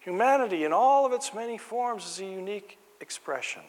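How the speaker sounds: preacher rising to a high proclamation; fervent preaching. Acted. Preaching about human uniqueness.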